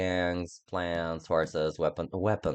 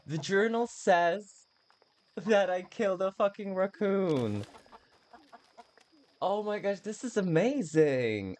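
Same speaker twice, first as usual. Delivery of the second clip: thrilled gushing; 11 semitones higher than usual. Real, not acted. Gamer gushing to viewers over the journal, high and thrilled.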